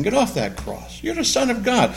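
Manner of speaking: a hint of a sly tempter; tempter's taunt. Satan tempting Jesus on the cross, lightly taunting. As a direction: coax him mildly off the cross. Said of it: "That's Satan saying".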